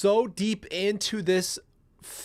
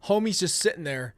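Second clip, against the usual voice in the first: ordinary talk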